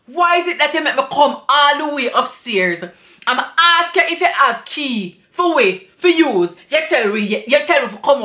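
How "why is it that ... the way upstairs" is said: usual speaking voice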